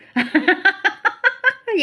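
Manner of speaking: loud burst of laughter at the joke. Real, not acted. Group member bursts out laughing at the joke. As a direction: laugh out loud, high and bright.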